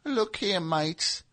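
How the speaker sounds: a small English manager, hushed; Peter Grant impression. Band manager addressing his mates, soft miniature English voice. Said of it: "mini Peter Grant".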